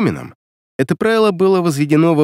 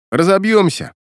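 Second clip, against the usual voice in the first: usual speaking voice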